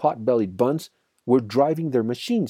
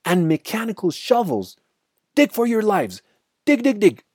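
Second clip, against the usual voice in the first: urgent shout, rising higher and louder; 12 semitones higher, 5 dB louder than usual. A narrator's reading. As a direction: shout frantic orders to save the family. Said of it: "shouted Mister Fox".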